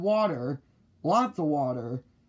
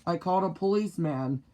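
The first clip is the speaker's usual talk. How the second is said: ordinary delivery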